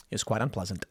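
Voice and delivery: muttered wry repeat; deadpan repeat. Shown as a demonstration. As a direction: drop it to a dry understated aside.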